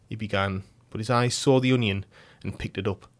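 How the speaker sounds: ordinary delivery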